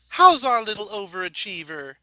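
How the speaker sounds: a hint of a cooing mother. A narrator's reading. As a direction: coo it sweetly to the daughter. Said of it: "she cooed".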